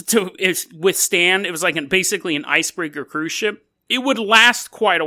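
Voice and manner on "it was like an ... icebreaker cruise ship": ordinary delivery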